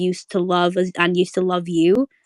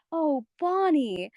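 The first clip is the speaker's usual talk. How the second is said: tender Marcy line; 6 semitones higher, much slower than usual. Retold or imitated. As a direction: draw the nickname out gently. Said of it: "Marcy goes".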